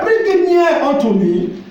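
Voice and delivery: slow, high, loud biblical command; Isaac's slow call. Retold or imitated. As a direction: proclaim it slowly like an old father. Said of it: "And he said".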